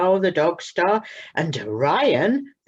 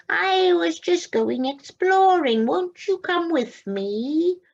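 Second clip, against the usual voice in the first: high, slow, coaxing cat voice; cat inviting the little owl exploring, high and slow; friendly cat; 13 semitones higher, 4 dB louder, slower than usual. Acted. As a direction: tempt the owlet along.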